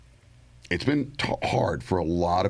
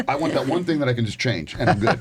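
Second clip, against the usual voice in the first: higher, hammed-up wishing; mock wishful voice; 7 semitones higher, 4 dB louder than usual. Host mocking people who want a silver bullet, higher hammed-up wishing. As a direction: play someone begging for one quick fix.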